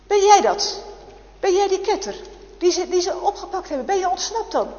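high, loud startled cry; startled sister. Acted. Sister to her filthy brother bursting in, startled and loud. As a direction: gasp it out in shock.

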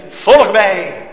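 loud commanding call of Christ. Acted. Christ calling the fishermen, loud commanding call. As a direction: call them away from their nets. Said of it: "Dan komt die stem".

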